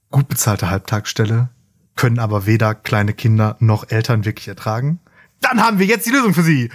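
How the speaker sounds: upbeat TV commercial pitch. Pretend. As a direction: sell it like a cheesy advert. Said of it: "Das bitte im Werbesprecherstil".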